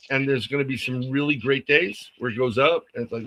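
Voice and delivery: usual speaking voice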